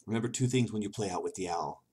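ordinary talk

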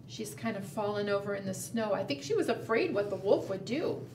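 ordinary delivery

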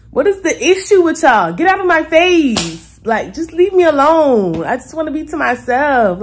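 loud, high and slowed, fed up; loud, high exasperated venting; exasperated rant. Real, not acted. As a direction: let it all out: leave me alone. Venting annoyance at strangers.

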